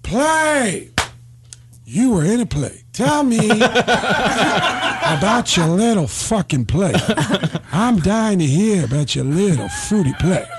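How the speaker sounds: sneering, drawn-out scorn; mocking Pacino. Pacino scoffing at Baldwin's play about him. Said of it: "And he goes".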